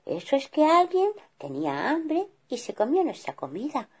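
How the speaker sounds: high, gentle calming wife; mother bear soothing her angry husband; tender, high reassurance; kind mother bear. Acted. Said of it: "que era muy buena".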